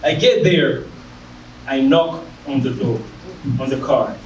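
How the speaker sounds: normal talking voice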